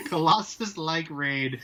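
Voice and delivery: ordinary talk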